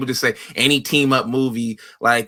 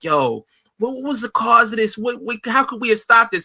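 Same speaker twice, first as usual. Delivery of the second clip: mock grieving parents; 10 semitones higher than usual. Retelling an SVU funeral scene, mock grieving parents. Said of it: "They're like".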